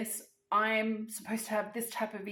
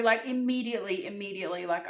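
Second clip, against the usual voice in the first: ordinary delivery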